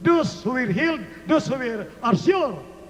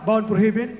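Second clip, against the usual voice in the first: normal talking voice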